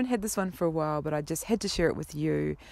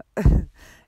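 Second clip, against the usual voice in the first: a sudden loud laugh; burst of laughter; 14 dB louder than usual. Real, not acted. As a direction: laugh out at yourself, caught off guard. Laughing at own confession.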